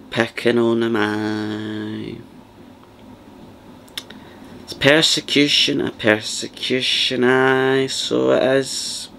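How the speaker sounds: slow, drawn-out Glaswegian parroting; lightly voiced Glaswegian dinner lady repeating everything; Glaswegian echoing friend. Third dinner lady echoing the others: slow Glaswegian parroting. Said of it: "good kind of rendition ... of what they sound like".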